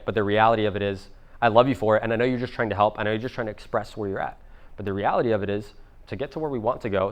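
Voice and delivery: soft, low, understanding parent voice; calm, human talk. Acted. Parent explaining to an upset little kid, calm and soft. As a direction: reason gently with an upset child. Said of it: "communicate with them like a human".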